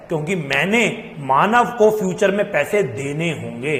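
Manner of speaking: usual speaking voice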